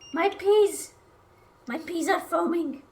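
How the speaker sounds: sudden high alarmed cry; panicked about the pot boiling over. Real, not acted.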